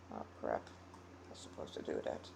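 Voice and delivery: whispered aside to self; muttered aside. Real, not acted. Reader catching a mistake off mic, whispered aside. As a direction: mutter it under your breath.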